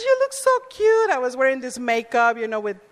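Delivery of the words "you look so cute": put-on high chirpy girl. Girl greets costumed speaker.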